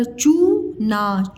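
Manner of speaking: चूना split slowly, long rising चू; syllable by syllable. Shown as a demonstration. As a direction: sound out चूना as चू, pause, ना. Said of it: "मैं अलग-अलग बताया करूँ". (चूना को अलग-अलग तोड़कर, धीरे)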